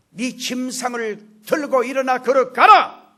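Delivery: slow, forceful order building to the end; loud command. Acted. Jesus ordering the paralytic to walk, loud command. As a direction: command it with full authority. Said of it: "명령을 했어요".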